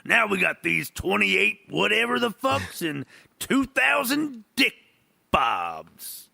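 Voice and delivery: lightly voiced grumpy old man, slowing down. Host mocks himself as an old man, lightly voiced. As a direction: grumble like a cranky geezer. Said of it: "I sound like an old man".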